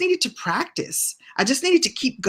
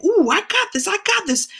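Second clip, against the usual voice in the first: put-on high, eager child voice, much higher; a kid sure she can do it; excited little girl; 12 semitones higher than usual. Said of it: "writing and saying".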